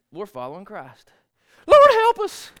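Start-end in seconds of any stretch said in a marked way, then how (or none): 1.7-2.5 much higher and louder desperate cry; panic-mode plea. Retold or imitated. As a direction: scream it scared to death. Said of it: "I believe they was in panic mode".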